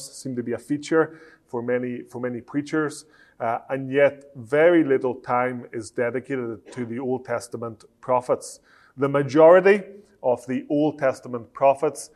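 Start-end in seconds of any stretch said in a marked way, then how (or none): none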